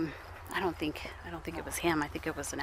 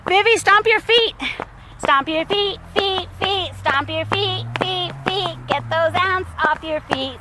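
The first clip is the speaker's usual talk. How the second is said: playful urgency; 8 semitones higher than usual. Real, not acted. Mother urging toddler to shake off ants, playful urgency. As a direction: chant it brightly, pitched well up.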